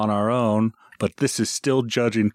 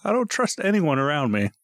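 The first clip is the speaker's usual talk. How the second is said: anxious passenger; 13 semitones higher than usual. Retold or imitated. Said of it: "I'm like".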